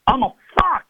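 high-pitched sudden outburst, imitating a boy; a boy's yell, mimicked far above your own pitch; imitated yell. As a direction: blurt it out in a high, strained mock yell. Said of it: "he just yells at me".

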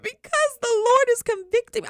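high, loud, hammed-up wail; mock crying. Crying student explaining to her friend, mock wail. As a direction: bawl it out in exaggerated tearful protest. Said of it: "Why are you crying?".

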